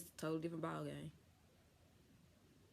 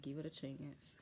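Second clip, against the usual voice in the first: much softer, lower, coaxing aside; soft murmur; 4 semitones lower, 10 dB quieter than usual. Retold or imitated. Nurse quietly urging the patient to give the doctor a chance. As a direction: hush her gently into patience.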